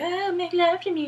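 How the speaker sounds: high-pitched imitated woman's come-on; hammed-up lovestruck plea; mock seductive. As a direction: swoon it in a put-on high voice. Mimicking mind-controlled Meryl's come-on.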